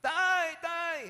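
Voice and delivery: very high, loud drawn-out shriek; acted piercing kid's cry for help; child screaming for dad. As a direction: yell out for your father at full pitch. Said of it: "sumisigaw pa rin".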